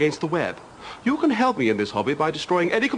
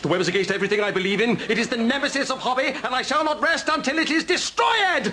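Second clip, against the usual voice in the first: mock fury; 7 semitones higher than usual. Ranting against the web.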